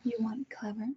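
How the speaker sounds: hushed, taunting whisper; a soft, threatening whisper to the prisoner; menacing whisper. Acted. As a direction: lean in and whisper the dare. Villain taunting her captive.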